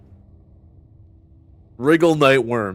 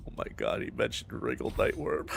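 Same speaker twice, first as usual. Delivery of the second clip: whispered, much lower and softer; stunned hushed whisper; awed whisper; 11 semitones lower, 12 dB quieter than usual. Real, not acted. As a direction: breathe it out in shock.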